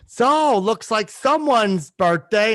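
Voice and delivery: very slow mock-cheery announcement; padded Cameo greeting. Shown as a demonstration. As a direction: stretch every word to fill time. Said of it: "So a lot of times I'll do".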